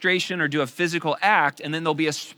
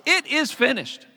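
slow, higher solemn proclamation; Jesus on the cross; 11 semitones higher, 6 dB louder, slower than usual. Retold or imitated. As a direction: declare it complete. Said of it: "Jesus said on the cross".

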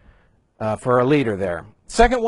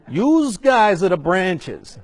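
put-on slow, higher, louder Jersey accent through laughter; Jersey accent, laughing; 7 semitones higher, 6 dB louder, slower than usual. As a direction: laugh while you do the Jersey line. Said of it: "my best Jersey imitation".

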